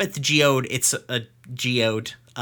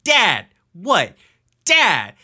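stunned calling; 6 semitones higher, 5 dB louder, much slower than usual. Retold or imitated. Steven stunned, calling dad.

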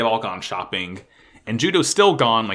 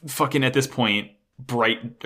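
ordinary talk, nothing marked

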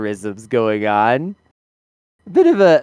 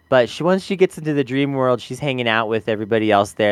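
ordinary talk, nothing marked

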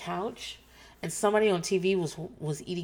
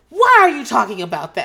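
louder, higher indignant mother; louder mock-offended mom; 5 semitones higher, 13 dB louder than usual. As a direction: snap back offended. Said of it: "she's like".

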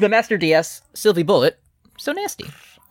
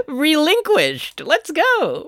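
high, slow put-on anime villain; 13 semitones higher, much slower than usual. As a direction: ham up a card game villain. Anime duel character voice.

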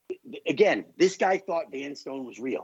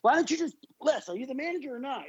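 high, fed-up question; exasperated promoter; 11 semitones higher, faster than usual. Retold or imitated. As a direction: demand it, losing patience.

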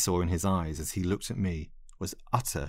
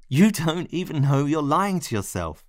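desperate insistence; 7 semitones higher, 3 dB louder than usual. Acted. Pleading argument with rejecting lover.

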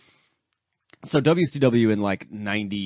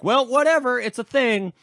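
high-pitched, exaggerated brush-off; mock breezy dismissal; 14 semitones higher, 4 dB louder than usual. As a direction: imitate them loudly waving it away in a high voice. Acting out promoters shrugging it off.

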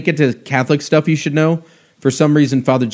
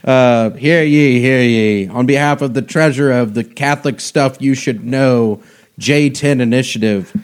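booming hear ye proclamation; bailiff. Pretend. Mock court proclamation, hear ye.

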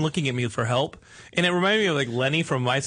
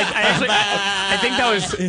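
laughing through the words, higher; can't stop giggling while speaking; 3 semitones higher, 3 dB louder than usual. Real, not acted. Laughing at the mix reveal.

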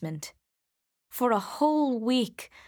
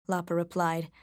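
plain reading voice

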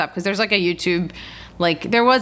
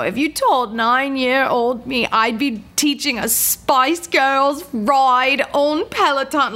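a clumsy, hammed-up British accent, slowing down at the end; bad British accent; 6 semitones higher, slower than usual. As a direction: imitate the British instructor's accent, badly. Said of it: "that was a terrible accent".

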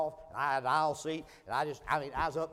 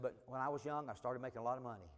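hushed and much softer; confiding quietly; 4 semitones lower, 10 dB quieter than usual. Retold or imitated. Stranger confessing his past to the preacher, quiet and confiding. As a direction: confess it low and personal. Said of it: "his tone began to change".

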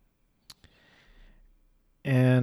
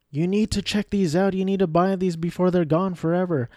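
higher, hyped sales pitch; pushy list voice; 7 semitones higher than usual. Retold or imitated. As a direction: sell it like a pushy ad. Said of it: "these games on a list that say".

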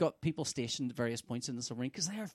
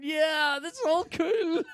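giddy excitement; 8 dB louder, much slower than usual. Retold or imitated. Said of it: "I'm going".

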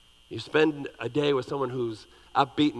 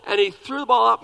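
normal talking voice

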